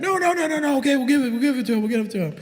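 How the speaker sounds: very high, frantic pleading voice; panicked bums backing down. Retold or imitated. Bums caving in when he threatens to take the beer back, frantic high squeal. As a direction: squeal it like scared guys caving in. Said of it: "They're like".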